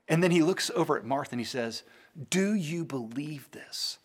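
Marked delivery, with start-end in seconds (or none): 2.3-4.0 a gentle, hushed question leaning in close; soft whispered question. Acted. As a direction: whisper it, slow and intimate. Jesus asks Martha, softly.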